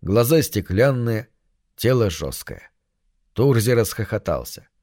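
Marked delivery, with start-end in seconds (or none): none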